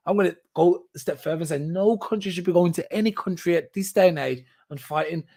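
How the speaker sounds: ordinary delivery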